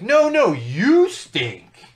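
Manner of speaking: put-on loud, high childish comeback; mock second grader. Other second grader answering an insult, mock childish yell. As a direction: imitate a kid yelling back a playground insult. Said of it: "the other kid's like".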